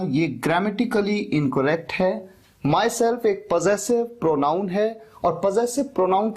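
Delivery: slow, careful teacher voice; deliberate slow explanation. Shown as a demonstration. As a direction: spell out the rule slowly. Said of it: "teacher explaining slowly". (धीमे, समझाते हुए)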